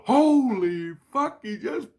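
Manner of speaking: very high, squealed mock scream; imitated scream. Teller imitates a Christ screaming about a punch, high mock scream. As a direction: squeal it in shock, drawing out the first words. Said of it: "one of my Christs scream out".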